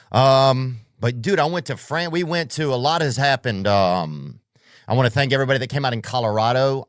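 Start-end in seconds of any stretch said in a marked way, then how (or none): 4.9-6.9 fast, louder tour-date ad read; ad-announcer voice thanking the crowd; advertiser voice. Acted. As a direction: rattle it off like a radio spot. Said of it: "I just went into that advertiser voice".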